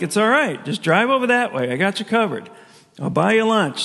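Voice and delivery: high, bright reassurance to a grumpy friend; placating reply. Retold or imitated. To the sulking neighbor, offering to buy lunch, pitched high. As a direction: talk him down from being mad.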